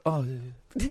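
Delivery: feebly expire, hammed-up and quiet; pretend dying groan. Woman at the till suddenly dropping dead, pretend dying groan. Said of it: "the woman behind the till would just go".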